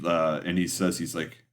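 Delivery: usual speaking voice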